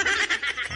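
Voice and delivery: a sudden high-pitched giggle. Real, not acted. Vlogger giggling at his own question. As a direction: burst into a squeaky laugh.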